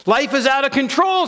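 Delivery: high, alarmed outburst; panicky inner voice. Acted. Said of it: "says my inner voice from the world".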